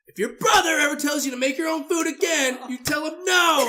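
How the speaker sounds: high, loud scolding shout; imitated parental tirade; angry mom yelling. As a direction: shout it like a fed-up mother. Mom yelling at him, retold.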